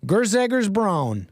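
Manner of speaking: Grzegorz said slow and Americanized, guh-ZEG-orz; American pronunciation. Shown as a demonstration. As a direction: drawl Grzegorz Braun the American way. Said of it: "I'm saying it like an American anchor baby".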